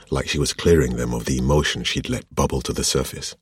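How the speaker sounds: plain reading voice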